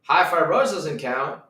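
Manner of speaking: normal talking voice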